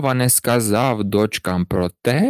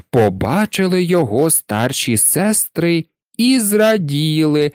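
plain reading voice

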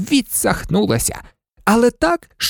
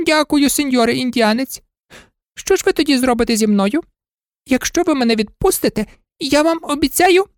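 much higher, relieved maid voice; grateful old maid; 7 semitones higher than usual. Acted. Maid thanks the Indian, high and eager.

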